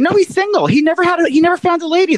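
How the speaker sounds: high, lively gossiping tone; excited gossip. Real, not acted. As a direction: spill the gossip eagerly. Excited gossip about Cuomo.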